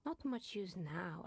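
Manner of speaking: ordinary talk, nothing marked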